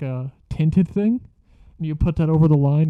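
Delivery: usual speaking voice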